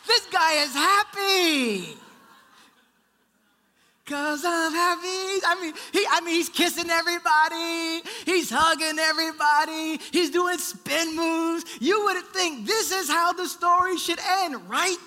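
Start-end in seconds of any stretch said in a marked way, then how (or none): 0.1-2.0 high, slow, loud joyful laughing line; excited. Acted. Excited echo while singing. 4.0-11.6 high and loud joyful praise; excited. Acted. Joyful acted happy man.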